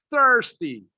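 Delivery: "Thirsty" with the opening TH stressed; emphasized TH. Shown as a demonstration. Teacher reminds learners of the T H, emphatic pronunciation. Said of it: "Remember the T H".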